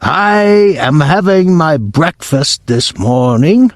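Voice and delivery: a haughty witch starting a rant; Grand High Witch. Acted. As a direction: let the grievance drag, slow and high. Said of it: "cried the Grand High Witch".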